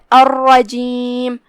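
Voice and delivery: الرجیم with a doubled, heavy "rr"; slowly, with a thick doubled ra in الرجیم; الرجیم drawn out with a heavy ra. Shown as a demonstration. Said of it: "ہم اسے بھاری آواز میں پڑھیں گے".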